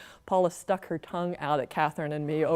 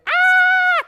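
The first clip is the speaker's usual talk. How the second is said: shrill high shriek, full force; piercing child's scream; terrified scream; 10 dB louder than usual. Acted. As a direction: scream like a kid who saw a werewolf. Paula screaming while running.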